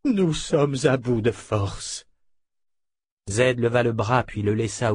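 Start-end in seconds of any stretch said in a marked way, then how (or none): 0.0-2.1 put-on slow, drained old woman's voice; bitter, worn out. A narrator's reading. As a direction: drag the words out, spent. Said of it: "souffla Anna, aussi amère". (amère, à bout de forces)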